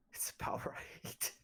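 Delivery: whispered aside, slow. Real, not acted. Joking aside.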